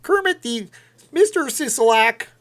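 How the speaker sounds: very high, loud puppet frog voice; strained amateur frog puppet impression; Kermit the Frog. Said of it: "Well, it's pretty bad".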